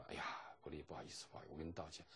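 very soft, low, nearly whispered; imitated hushed apology. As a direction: apologise gently, as if consoling a friend. Acting classmate's gentle consoling apology. (模仿同学压低声音轻声道歉)

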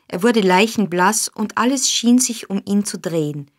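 plain reading voice

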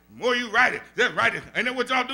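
usual speaking voice

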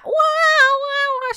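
high loud mock wail; imitated wailing. Imitating the woman singing loudly beside her, high mock wail. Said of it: "și făcea".

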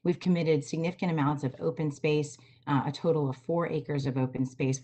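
ordinary delivery

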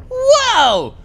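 loud, high surprised shout; surprised whoa. Real, not acted. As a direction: gasp out a sudden whoa. Finds the hidden secret.

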